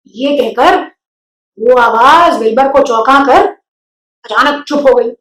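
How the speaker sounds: plain reading voice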